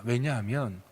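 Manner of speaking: ordinary talk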